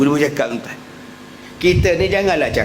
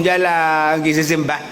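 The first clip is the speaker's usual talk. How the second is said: ordinary delivery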